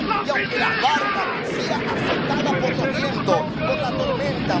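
impassioned pulpit shout; full-force preaching. Acted. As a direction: drive it home to the whole congregation, building. Preaches at full force over music.

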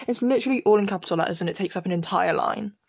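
usual speaking voice

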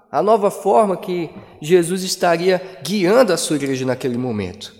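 ordinary talk

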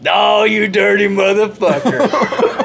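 mock scandalized delight. Buddy learning a girl is over, mock scandalized delight. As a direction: draw it out in gleeful mock outrage. Friend's reaction to news acted out.

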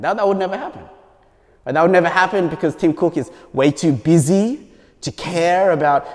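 ordinary talk

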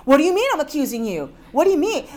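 hammed-up defensive retort; mimicked indignant friend. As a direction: fire back as the offended friend.

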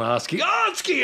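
high, drawn-out rallying yell; retold yell. Telling how they yelled it senior year, high retold yell. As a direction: holler it high, stretched out, without full volume. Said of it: "I just started yelling".

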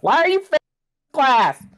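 high, loud mock mom yell; high loud mother demanding answers. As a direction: shout it like an angry mom. Mom yelling on phone.

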